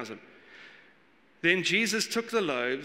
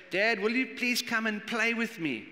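put-on high, childlike plea from a small girl; little daughter's voice; 5 semitones higher than usual. Preacher imitating his young daughter asking Dad to play, childlike plea. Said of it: "she used to say".

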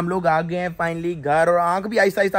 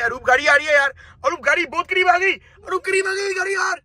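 building from plain to much higher; rising mock alarm; 11 semitones higher than usual. Passenger warns the driver of an oncoming car in mock panic. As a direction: climb into hammed-up panic.

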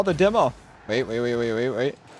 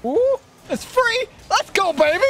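high excited cheer; 13 semitones higher than usual. Real, not acted. Gamer celebrating an open net, high cheer.

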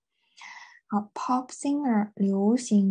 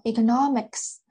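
"economics" with main stress on -no-; stress before the shift. Shown as a demonstration. Teacher giving the base word to students, "economics" stressed on -no-. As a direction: lean on the third syllable of "economics". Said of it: "这个重音是往前了".

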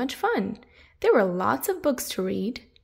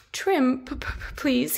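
nervous stammer; 3 semitones higher than usual. Acted. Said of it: "felt a bit nervous".

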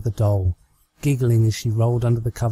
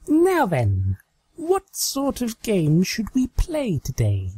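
lightly raised, playful unicorn mare musing to herself; a higher, girlish Trixie voice, plotting a game; Trixie's voice; 10 semitones higher, slower than usual. Acted. Trixie alone with her doll, musing in a raised girlish voice. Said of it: "Trixie mumbled to herself".

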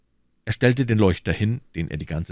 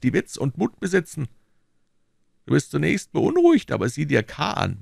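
höher gesprochener, drängender McFarland; überredet den Freund mit Nachdruck; McFarland, pushing hard; 10 semitones higher, 3 dB louder than usual. Acted. McFarland voiced. (McFarland drängt Fettes, höhere eindringliche Stimme)

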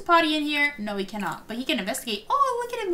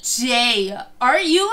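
ordinary delivery

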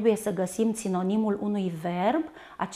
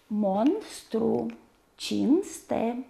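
despărțit în silabe; much slower than usual. Shown as a demonstration. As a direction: break each word after its first consonant of the cluster. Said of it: "despărțirea se face". (rostit pe silabe, cu pauze)

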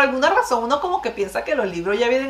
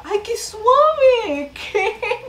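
stretched delight; 10 semitones higher, much slower than usual. Real, not acted. Reacting to soft plant.

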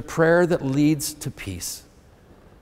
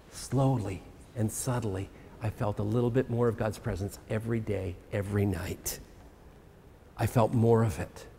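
soft, intimate; 7 semitones lower, 11 dB quieter than usual. Real, not acted. Quiet intimate turn in sermon.

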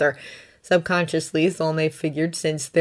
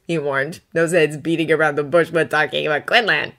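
ordinary delivery, nothing marked

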